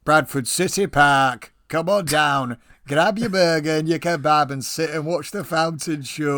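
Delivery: loud, drawn-out promotional holler; mock park advert. Host mocking the park's unglamorous name, loud mock advert. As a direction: sell it like a hammed-up local advert.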